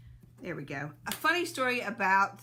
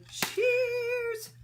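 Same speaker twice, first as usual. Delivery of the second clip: cheerful toast; 4 dB louder than usual. Real, not acted. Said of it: "did you hear that go".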